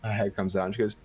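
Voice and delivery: ordinary delivery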